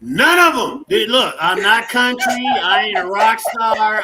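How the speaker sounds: loud, firm no; emphatic refusal. Real, not acted. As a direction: shut the question down hard.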